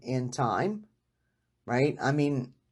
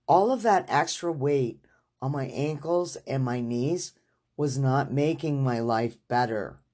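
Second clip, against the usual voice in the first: ordinary delivery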